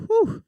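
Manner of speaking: high panicked whew. Retold or imitated. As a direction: let out a shrill panicked whew. Dad appears around corner.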